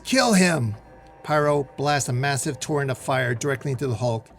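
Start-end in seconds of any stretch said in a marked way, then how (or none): 0.0-0.8 loud, high battle cry; fierce raised shout; yelling. A narrator's reading. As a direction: yell it as an order to attack. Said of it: "As Marrow yells".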